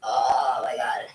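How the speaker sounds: long, slow, low voiceless groan; straining in pain. Real, not acted. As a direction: groan it out slowly under the strain. Straining in a painful stretch.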